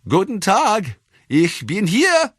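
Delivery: put-on high, slow old German man calling down; shouted greeting from a window. A narrator's reading. Old painter calls down from his window, high shouted greeting. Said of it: "pops out from a top floor window and shouts".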